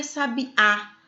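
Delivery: "sabiá" with a separated, stressed final Á. Shown as a demonstration. Teacher showing where the accent falls, "sabiá" stressed on Á. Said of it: "o acento é no A".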